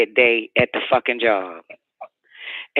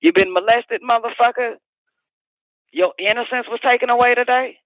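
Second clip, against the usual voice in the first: higher, heated retort; retold angry challenge; 8 semitones higher than usual. Retelling her comeback to a drunk caller, heated and high. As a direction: throw his complaint back at him hard. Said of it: "I said".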